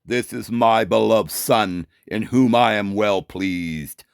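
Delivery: low, slow, solemn voice of God; voice from heaven. Acted. Teacher voicing God from the cloud, deep, slow divine voice. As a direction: intone it deep and drawn out. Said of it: "you hear this voice".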